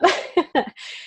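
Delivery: short high-pitched laugh. Real, not acted. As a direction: burst out laughing. Laughing at her own resolve.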